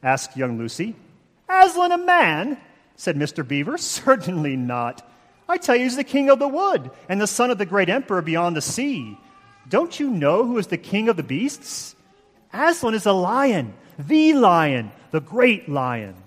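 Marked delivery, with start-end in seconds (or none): 1.5-2.6 put-on incredulous high, loud squawk in English accent; horrible English accent. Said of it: "my horrible English accent". 3.8-16.2 put-on Mr. Beaver's emphatic proclamation in English accent; horrible English accent. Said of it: "my horrible English accent".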